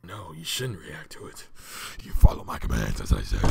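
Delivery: low ominous master giving orders; deep commanding voice. Pretend. Continues commanding put-on voice.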